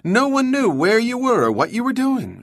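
words tumbling out quickly, voice climbing high; rush of words. A narrator's reading. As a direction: gush it all out in one breath. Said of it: "she said in a rush of words".

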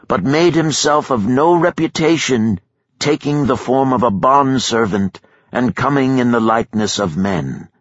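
high ringing public proclamation; loud proclamation. Acted. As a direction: announce it to the whole crowd. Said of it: "said with a loud voice".

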